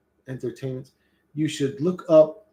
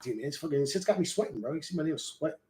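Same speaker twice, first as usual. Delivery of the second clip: usual speaking voice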